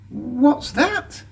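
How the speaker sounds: high, soft worried question; anxious. A narrator's reading. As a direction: ask it nervously. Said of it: "asked Thomas anxiously".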